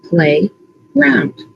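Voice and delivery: "Play, ground" with a clear gap between parts; "Play, ground" as two separate pieces; split into word parts. Shown as a demonstration. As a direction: say "Play", pause, then "ground". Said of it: "What are the parts in playground?".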